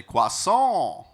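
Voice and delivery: high, loud French-nasal Croissant; French nasality. Shown as a demonstration. Coach imitates a French speaker with nasal resonance. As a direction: say Croissant through the nose the French way. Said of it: "French nasality".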